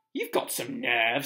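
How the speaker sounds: slow, indignant, loud retort. A narrator's reading. Ron objects to Hermione's plan, loudly. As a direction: draw it out loudly in affront. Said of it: "said Ron loudly".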